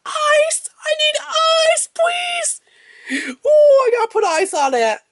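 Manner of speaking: very high mock-agonised squeal; squeezed falsetto. Host begging for ice after a squeeze, hammed-up falsetto agony. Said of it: "squeeze where you're like".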